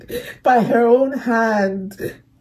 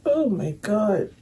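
holding back tears; 5 semitones lower, 4 dB quieter than usual. Real, not acted. Fan trying not to cry over the scene, choked up. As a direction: fight the tears as you speak. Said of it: "I'm trying not to cry".

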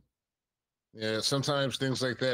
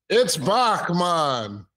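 loud, high, very drawn-out fake Caribbean call; hammed-up Caribbean holler; bad Caribbean accent; 6 semitones higher, 7 dB louder, much slower than usual. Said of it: "with a bad Caribbean accent".

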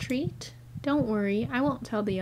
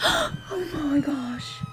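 scared gasp; 4 semitones higher, much slower than usual. Real, not acted.

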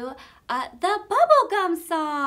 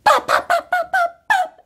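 bubble popping; 7 dB louder than usual. Pretend. Bubble bursting.

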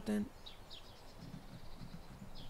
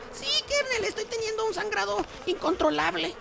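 very high put-on voice; voz aguda fingida. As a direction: report it in a squeaky mock-distressed falsetto. Acts out a squeaky bleeding patient. (vocecita aguda y exagerada)

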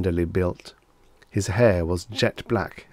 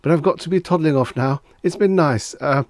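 high, loud, hurried babble; flustered, pitched-up chatter; nervous excuse; 7 semitones higher, 6 dB louder than usual. A narrator's reading. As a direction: babble politely while edging out. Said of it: "He coughed nervously".

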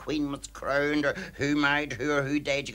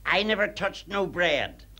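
indignant denial; 6 semitones higher, slower than usual. Acted. Cleaner denies in acted quarrel.

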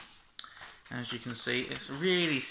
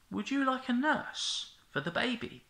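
put-on little girl voice; 9 semitones higher than usual. Miriam asks the princess.

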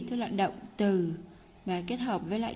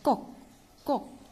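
short, punched, higher "꼭"; emphasised "꼭"; 4 semitones higher, faster than usual. Shown as a demonstration. Teacher showing the determination in it, stress on "꼭". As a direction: hit each "꼭" with resolve. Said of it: "꼭 này cũng nhấn mạnh lên một tí".

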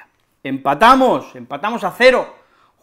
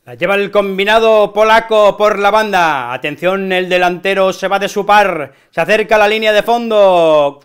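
building, louder commentator call; play-by-play commentary; 2 semitones higher, 5 dB louder than usual. Acted. Fan narrating a Polish attack as commentator, building.

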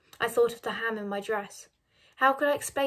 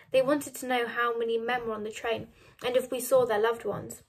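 ordinary delivery

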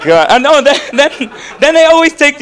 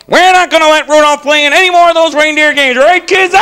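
loud, high-pitched mocking coach; 7 semitones higher, 4 dB louder than usual. The reindeer coach taunting Rudolph in front of the kids, loud mock bellow. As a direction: ham up the bossy coach jeering at Rudolph. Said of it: "He's like".

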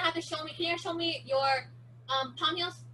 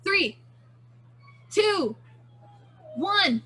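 loud race countdown, long gaps; countdown call; 3 semitones higher, 5 dB louder, slower than usual. Shown as a demonstration. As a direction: count them down loudly, then spring the fake. Fake countdown to start the race.

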